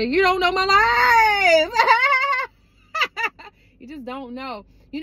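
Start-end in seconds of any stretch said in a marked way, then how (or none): 0.0-1.7 sassy. Acted. Sassy retort to rumor-spreading followers. 1.8-2.5 high burst of laughter. Real, not acted. Laughs after sassy line.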